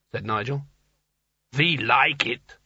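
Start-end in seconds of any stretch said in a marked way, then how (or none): 1.6-2.6 slow, rumbling alien giant. A narrator's reading. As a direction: rumble it slowly like an ice giant. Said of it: "the giant speaks".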